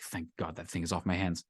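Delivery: low, very soft acted aside; muttered relief. Giver walks away rid of the bill, muttered relief. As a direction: drop it low, as if to yourself.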